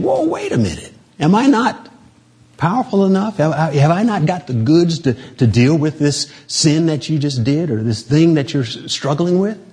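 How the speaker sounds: high, incredulous voice of Jesus; Jesus pushing back, amazed and high; voicing Jesus. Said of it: "And Jesus is saying".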